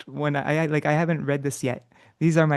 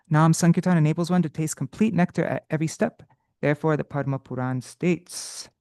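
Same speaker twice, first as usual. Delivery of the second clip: ordinary delivery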